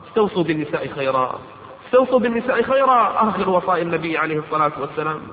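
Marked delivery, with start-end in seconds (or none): 1.9-3.3 higher and louder urging of the command; emphatic repeat. Acted. Preacher urging husbands, repeating the command louder and higher. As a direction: raise the voice to drive the command home.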